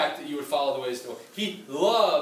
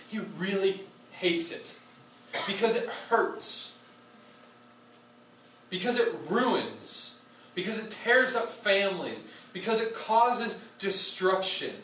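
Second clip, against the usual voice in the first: slow emphatic; 3 semitones higher, slower than usual. Real, not acted. Preacher building slow emphasis.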